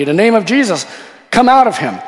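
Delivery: loud, high, forceful order to a spirit; acted exorcism command. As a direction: order the demon out, re-enacted. Acts out second exorcism command.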